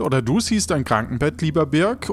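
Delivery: normal talking voice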